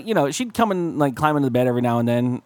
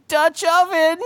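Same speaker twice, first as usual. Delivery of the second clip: high-pitched imitation of a little girl; gleeful kid announcing a prank, pitched way up; 13 semitones higher, 4 dB louder, slower than usual. Little girl springing a blanket prank, high child voice. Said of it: "And she's like".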